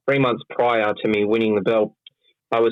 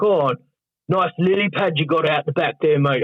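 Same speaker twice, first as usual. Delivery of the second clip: put-on English accent, pitched up; Englishman; 5 semitones higher than usual. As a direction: play a chirpy English delivery man. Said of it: "You're speaking like an, a freaking Englishman right now".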